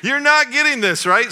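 high and loud disbelief; can't believe he's not getting it; a father amazed his son missed the point; exasperated. Retold or imitated. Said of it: "I was like".

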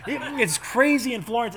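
hammed-up reporter in a storm; mock storm reporter. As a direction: shout it over pretend wind. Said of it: "the guy from the Weather Channel".

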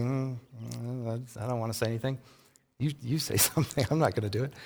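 sheepish mock mumble; mumbled reluctance. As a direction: mumble it like you hope nobody asks you. People voiced dodging Elijah's question.

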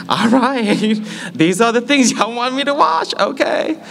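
very high, mock-agreeable voice; high-pitched reaction. Younger self answering friends about their show picks, high-pitched. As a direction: pitch it way up, playing along.

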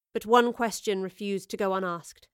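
usual speaking voice

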